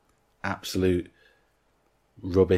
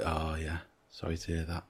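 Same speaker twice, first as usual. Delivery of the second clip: much softer, imitating a sympathetic boss; muted sympathy; 11 dB quieter than usual. Boss hearing an underling's mum is ill, muted sympathy. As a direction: quietly offer token condolences, retold in passing.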